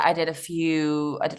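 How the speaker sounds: ordinary delivery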